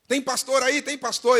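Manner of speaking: high, pressing repeated question; urgent calling out. Acted. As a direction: call out like a youth running for help. Teenager runs up calling out.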